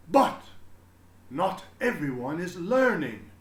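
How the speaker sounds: high, loud and slow, booming with pride; a beaming principal proclaiming to everyone; proud headmaster announcing. A narrator's reading. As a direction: announce it grandly to the whole school. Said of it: "Mister Keene said".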